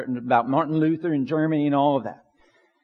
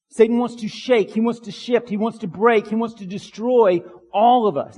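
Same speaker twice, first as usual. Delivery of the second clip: louder, higher, hammering each repeated phrase; preaching at full force; 5 semitones higher, 3 dB louder than usual. Acted. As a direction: build the sermon to a fiery climax. Rising preaching against Satan.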